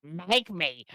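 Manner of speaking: high, far-off witchy voice; witch voice. Acted. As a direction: sneer it like a witch. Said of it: "What was that voice?".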